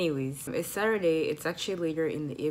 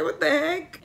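higher, louder what the heck; mock disbelief; 10 semitones higher, 6 dB louder than usual. Retelling how baffled she felt at her mood. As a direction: throw up your hands at yourself. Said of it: "I was like".

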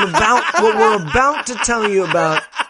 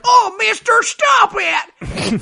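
mock kid cry; 11 semitones higher, slower than usual. Podcaster voicing a terrified kid in the movie, high mock plea. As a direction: squeal like a frightened little boy. Kid begging during ear puncture.

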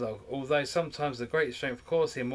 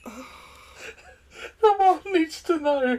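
slow, high, loud words through laughter; real laughing fit, pitched up; helpless laughter; 12 semitones higher, 7 dB louder, much slower than usual. Real, not acted. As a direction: struggle to speak while cracking up. Helpless laughter after own hiccup.

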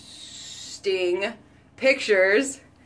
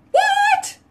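much higher, louder squeaked what; mock surprise; 4 dB louder than usual. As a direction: play shocked at the news. Mock surprise at own news.

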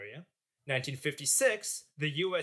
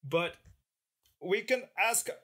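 hammed-up Russian accent, pitched higher; mock Russian accent; mock Soviet accent; 7 semitones higher than usual. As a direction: play a Soviet official with a thick fake Russian accent. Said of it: "What sort of accent is this".

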